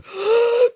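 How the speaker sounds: a quick, very high gasp; mock sharp gasp. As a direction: suck in air like a startled man. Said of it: "And then Bucky goes".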